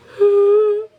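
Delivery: a big drowsy exhale; imitated tired dog's sigh. Tired dog drooping eyes.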